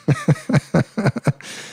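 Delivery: high staccato giggle; real high-pitched amused laugh. Real, not acted. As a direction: crack up in quick high bursts. Cracking up at emojis.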